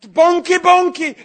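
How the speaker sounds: high, loud prophetic yell; yelling a prophecy. Retold or imitated. Preacher retells yelling a prophecy at the fire tunnel, loud and high. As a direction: belt it out like a prophecy pouring out. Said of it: "I was yelling in the pastor's ear".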